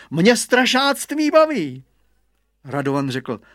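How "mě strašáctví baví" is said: high, loud, drawn-out brag; boastful boy. A narrator's reading. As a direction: crow it proudly and slowly. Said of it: "naparoval se Hugo". (chlubivě, nahlas)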